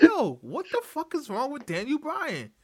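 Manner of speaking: high-pitched acted bafflement; incredulous reaction. Acts out his disbelief while watching.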